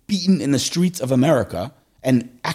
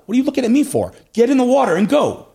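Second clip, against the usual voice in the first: Hashem impatient; 12 semitones higher, 5 dB louder than usual. Retold or imitated. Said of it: "What does Hashem say?".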